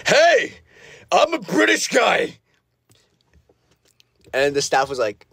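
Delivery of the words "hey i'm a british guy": high, drawn-out bad British accent; screamed British guy. Showing staff a British guy impression, high screamed accent. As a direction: yell out a silly British impression. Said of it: "my impression of a British guy".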